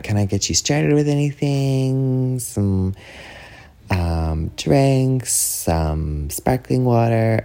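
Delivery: slow sing-song offer of service; restaurant server. Retold or imitated. Server taking the drinks order, high and drawn out. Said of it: "the server will be like".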